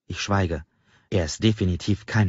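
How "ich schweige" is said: ordinary delivery, nothing marked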